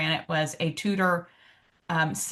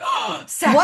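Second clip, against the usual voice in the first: high, loud theatrical outrage; mock shock; 10 semitones higher, 5 dB louder than usual. Teasing a tea speaker who never watched Downton Abbey, mock shock. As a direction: play up the scandal. Acting out her shocked reply.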